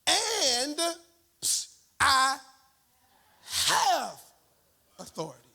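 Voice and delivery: each word set apart, climbing high; slow preacher build, word by word; dramatic pauses. Real, not acted. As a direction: land "And I have authority" one word at a time. Dramatic word-by-word sermon climax.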